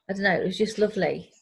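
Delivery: normal talking voice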